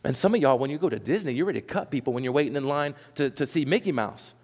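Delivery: normal talking voice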